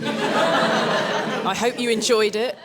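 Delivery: slow words through laughter; laughing. Real, not acted. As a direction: laugh while saying it. Jokes about dating sim.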